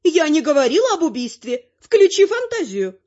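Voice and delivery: high-pitched granny correcting someone; exasperated granny. A narrator's reading. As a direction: chide in a high old-lady voice. Narrator voices the grandmother.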